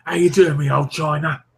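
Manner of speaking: East End patter; overacted East End accent. Chatting to a cousin, overacted East End accent. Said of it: "Overact the East End accent".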